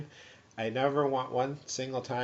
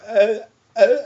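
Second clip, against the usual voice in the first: loud, higher strangled grunts; tongue-tied grunting; 9 semitones higher, 14 dB louder than usual. Shown as a demonstration. Patient failing to answer worried caller, grunting. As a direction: push out stuck sounds, no words. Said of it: "And I'll be going".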